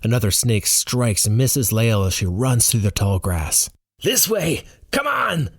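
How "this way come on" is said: high-pitched shout, volume held back; urgent shout. A narrator's reading. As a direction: call them to follow, urgently. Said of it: "Roar shouts".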